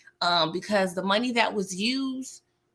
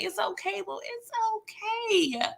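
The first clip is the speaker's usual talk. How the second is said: soothing; 12 semitones higher, 4 dB quieter than usual. Retold or imitated. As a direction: coo it like comforting a child. Wants to embrace crying Little Murder.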